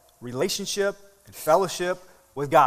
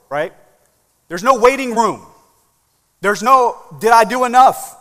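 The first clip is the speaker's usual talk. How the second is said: loud, raised sermon voice; driving pulpit delivery; impassioned preaching; 6 semitones higher, 9 dB louder than usual. Real, not acted. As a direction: preach it with full force, higher and louder. Preaches at full force.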